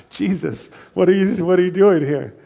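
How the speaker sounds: a loud, playful cry of disbelief; half-laughing, call out to Jesus in mock alarm; loud teasing exclamation of disbelief; mock shocked outburst.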